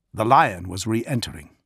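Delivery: plain reading voice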